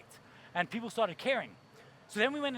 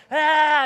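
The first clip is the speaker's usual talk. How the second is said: loud frustrated roar; frustrated yell; 6 semitones higher, 14 dB louder than usual. Retold or imitated. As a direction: bellow in frustration. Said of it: "He's like".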